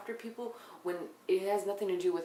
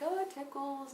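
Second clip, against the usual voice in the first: higher, girly mock voice; mocking giggly Nikki; 7 semitones higher than usual. Imitating Nikki at the pedicure, high and girly. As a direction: tease her as a giggling girl. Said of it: "She's like".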